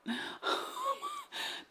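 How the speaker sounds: high, breathy squeal of a laugh; squeaky laugh. Real, not acted. Vlogger laughing at her own result, squeaking. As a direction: let a squeaky giggle escape.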